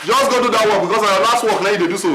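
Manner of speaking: fast scolding. Acted. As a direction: dismiss them quickly and sharply.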